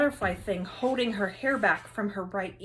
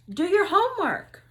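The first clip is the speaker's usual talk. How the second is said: called-out answer; 9 semitones higher, 8 dB louder than usual. Acted. Kids calling out answers.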